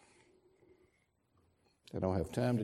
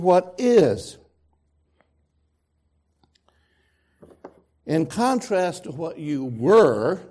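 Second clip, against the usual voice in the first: louder, driving pulpit delivery; forceful preaching; 6 semitones higher, 7 dB louder than usual. Acted. Preacher to congregation, forceful sermon delivery. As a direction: press the point home from the pulpit.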